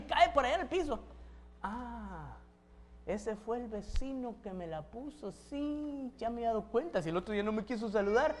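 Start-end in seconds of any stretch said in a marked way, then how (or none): 1.6-8.4 hushed, paranoid grumble to oneself; acted quiet suspicion; suspicious muttering. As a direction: mutter it softly, piecing the plot together.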